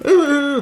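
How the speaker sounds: loud, high imitated wail; exaggerated burst of pretend crying; mock crying wail. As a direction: wail like an upset little girl. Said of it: "She's crying and she's crying".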